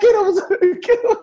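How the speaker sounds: high-pitched mock shout of encouragement; imitated cheering on. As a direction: holler like a ringside heckler egging on his man. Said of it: "[name] walked in like".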